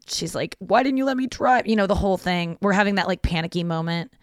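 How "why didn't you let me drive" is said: high-pitched imitation of upset sister; nearly crying sister. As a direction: retell it as a distressed, high complaint. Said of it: "like, nearly crying".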